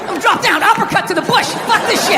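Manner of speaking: high-pitched mock outrage; hammed-up indignant rant; worked-up bravado. Acts himself working up to revenge.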